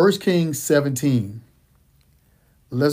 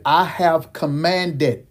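"I have commanded" said very slowly, stressed; stressed slow quote; 2 semitones higher, much slower than usual. Shown as a demonstration. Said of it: "I need you to underline that".

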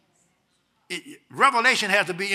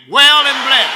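high, loud shout of the phrase; loud, high demo of the answer; loud shouted response; 8 semitones higher, 16 dB louder, slower than usual. Shown as a demonstration. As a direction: belt it out as an example. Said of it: "Say it again".

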